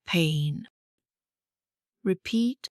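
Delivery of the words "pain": pain with a long A sound. Shown as a demonstration. Teacher models long vowel for learners, clear long pain. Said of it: "Now say".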